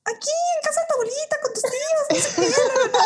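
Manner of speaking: high, fast, chirpy mock mom voice; imitating her mom. Daughter imitates her unaware mom answering the phone, high fast mock voice.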